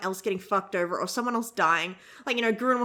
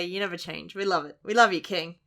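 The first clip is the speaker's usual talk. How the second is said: ordinary talk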